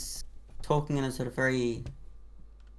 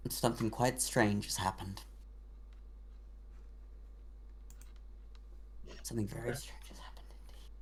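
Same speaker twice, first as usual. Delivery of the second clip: hushed wonder; 3 semitones lower, 12 dB quieter than usual. Acted. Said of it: "and is like".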